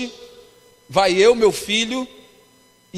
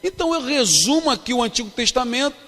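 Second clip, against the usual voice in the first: fervent preaching; 5 dB louder than usual. Acted. Pastor preaching to a youth congress, fervent and emphatic. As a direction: preach it to the crowd, building.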